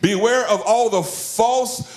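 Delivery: high, urgent pulpit warning; impassioned preaching. Real, not acted. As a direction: sound the alarm to the congregation, pitch held high. Preaches warnings at full force.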